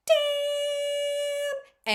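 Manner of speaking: very high, long-held damn; wolf-whistle of a word, stretched high; admiring exclamation. Acted. Character spots naked orc, reacts.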